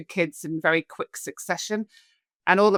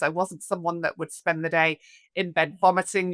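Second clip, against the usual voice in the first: usual speaking voice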